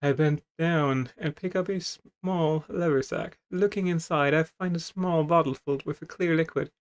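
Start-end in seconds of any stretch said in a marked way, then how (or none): none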